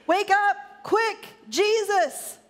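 high, urgent cries to wake someone; screamed plea. A narrator's reading. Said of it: "they screamed".

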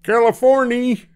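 very high, loud holler; jubilant shout. A narrator's reading. Driver announcing California to the coach, shouted announcement. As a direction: holler out the arrival from the driver's seat. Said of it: "shouted the driver".

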